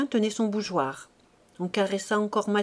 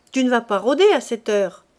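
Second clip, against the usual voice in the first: calling out; 8 dB louder than usual. A narrator's reading. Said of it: "cria-t-elle".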